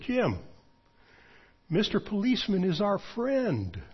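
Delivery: put-on high, sing-song children's-rhyme voice; joking singsong. Joking to his friend to lighten the mood, high singsong. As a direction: tease him lightly like a kids' slogan. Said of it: "as a joke, I grinned".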